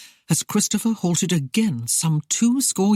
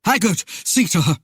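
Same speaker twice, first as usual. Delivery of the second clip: firm order, slightly raised voice; brisk note of authority; commanding; 3 semitones higher than usual. A narrator's reading. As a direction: command a subordinate. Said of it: "he commanded".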